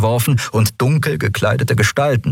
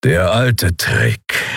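growled grudging praise; much slower than usual. Acted. Kero watches the raid from the bridge, grudging growl. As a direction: growl it out as if impressed against your will. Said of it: "knurrte Kero mit widerwilliger Anerkennung".

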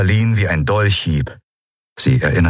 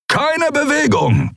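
much higher, slow barked order; thundering command; slower than usual. Acted. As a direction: freeze everyone with one thundered command. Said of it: "donnerte ihr Anführer".

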